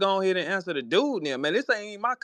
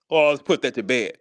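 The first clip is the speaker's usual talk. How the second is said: much lower mock robot voice; mimicking robotic talker; 9 semitones lower than usual. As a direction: drop low to mock his sign-off. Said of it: "That ain't how humans communicate".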